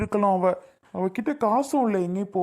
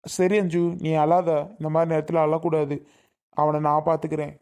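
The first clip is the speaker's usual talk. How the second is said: low, steady comforting voice; calm her and promise to handle it; reassuring; 5 semitones lower than usual. Acted. Brotherly man tells her not to cry, reassuring.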